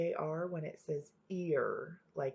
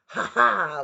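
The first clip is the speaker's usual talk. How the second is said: loud mocking "Ha, ha"; fox laughing; 3 semitones higher, 15 dB louder than usual. Fox laughs as the cheese drops, loud and mocking. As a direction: laugh in triumph over the crow. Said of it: "laughed the fox".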